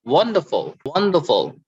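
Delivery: "wonderful" with WON high, then falling; stress on first syllable. Shown as a demonstration. Teacher modelling words for students to repeat, first-syllable stress on "wonderful". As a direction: punch WON in each "wonderful". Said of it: "so we stress on the first".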